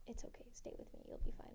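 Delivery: soft voiceless whisper; whispered reassurance. Retold or imitated. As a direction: hush her gently. Dad's calm line, repeated.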